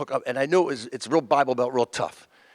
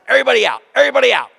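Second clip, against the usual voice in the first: high, loud everybody out; clearing the room; 8 semitones higher, 5 dB louder, much faster than usual. Retold or imitated. Waitress clearing the club, calling out high and loud. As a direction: herd them out the door.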